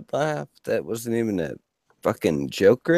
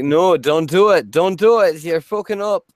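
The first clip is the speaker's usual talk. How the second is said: inner Irish voice; 5 semitones higher, 5 dB louder than usual. Pretend. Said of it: "It's like".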